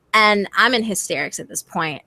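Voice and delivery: ordinary talk, nothing marked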